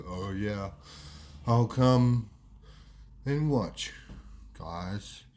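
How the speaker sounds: very slow with long gaps between words; slowed-down speech. Acted. Wado turns down playing footy, speaking very slowly. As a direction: imitate delayed speech, dropping low at the start and end. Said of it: "He's talking a lot slower".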